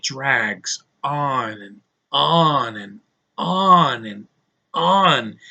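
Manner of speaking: very slow, each "on" stretched out; dragged out. Shown as a demonstration. Reviewer slowly mimicking how the first hour drags on. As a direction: draw the words out like the film drags.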